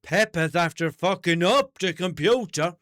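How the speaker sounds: loud, high, slow-drawn cartoon mum; Mammy Pig. Mammy Pig announcing the broken computer, loud mock outrage. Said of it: "that's the one where Mammy Pig says".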